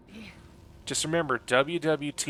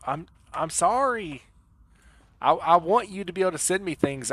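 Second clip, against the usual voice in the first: pleading apology; 5 semitones higher, 4 dB louder than usual. Real, not acted.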